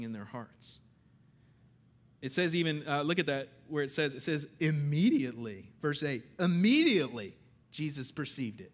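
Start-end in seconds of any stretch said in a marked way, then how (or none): 4.6-5.7 "Immediately" punched loud; emphatic reading. Real, not acted. Stresses Mark's favorite word while reading. 6.4-8.7 "Immediately" hit loud with a big pitch swoop; heavy stress on the first word, "Immediately"; emphatic reading. Real, not acted.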